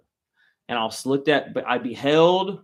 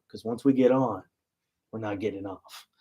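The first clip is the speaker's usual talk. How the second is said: ominous dad warning; 5 semitones lower than usual. Retold or imitated. Dad's ominous last warning.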